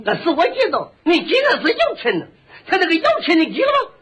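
high-pitched Shandong arguing; exaggerated Shandong shopkeeper; Shandong butcher. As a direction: insist on the payment in thick Shandong dialect. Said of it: "我论着管他叫张大爷".

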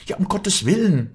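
high, loud cry of alarm. A narrator's reading. Walli realises Josef will shoot her bird, horrified cry. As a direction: exclaim in horror. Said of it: "schrie Walli".

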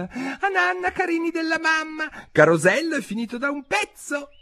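very high, thin canary squeak; tiny, trembling falsetto calling children to bed; tremulous little voice. A narrator's reading. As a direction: twitter like an anxious mother bird. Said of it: "dicendo con una vocina tremula".

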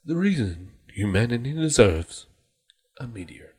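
softly fading show intro; mock segment announcer. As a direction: drop the voice away for a solemn title reveal.

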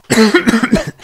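harsh choking cough; coughing fit. Real, not acted. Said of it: "perdón, es que me ahogo".

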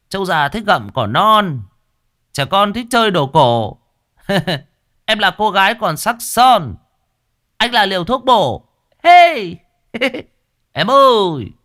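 high, loud tipsy heckler hooting at the couple; drunk teasing catcall. A narrator's reading. Drunk Long heckling a couple on a bench, loud mocking catcall. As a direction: jeer at the lovers like a cheeky drunk. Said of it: "thằng Long ngứa mồm".

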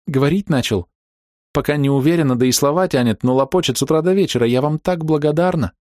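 eager, thankful mother voice; woman's voice. Acted. Grateful mother on phone.

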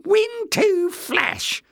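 very high, drawn-out resentful echo; resentful agreement. A narrator's reading. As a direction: concede it slowly in a high, sour voice. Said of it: "Jinx agreed resentfully".

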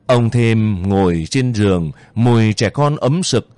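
usual speaking voice

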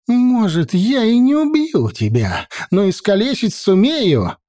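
high, fierce threat; furious. Acted. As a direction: spit it out in fury, pitched high. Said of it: "яростно заговорила она". (яростно, на высоких тонах)

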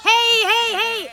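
laute, hohe Hey-Rufe; Scheuchrufe. Acted. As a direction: scheuch die Ziegen. Ziegen scheuchen. (laute Hey-Rufe zum Treiben)

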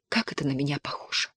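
whisper. Acted. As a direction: breathe it out in shame. Wife ashamed, trailing off.

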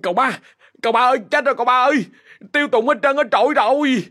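frightened servant bursting in on his master; stammering in panic. Acted. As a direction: gasp it out like a terrified servant. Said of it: "miệng lắp bắp".

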